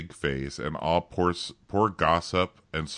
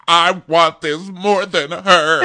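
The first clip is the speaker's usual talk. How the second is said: higher, slow pleading singer voice; mock desperate contestant; 11 semitones higher, 9 dB louder, slower than usual. Mocking American Idol contestants, higher and pleading. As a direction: beg like a desperate Idol hopeful. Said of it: "they're like".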